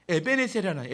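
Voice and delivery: ordinary talk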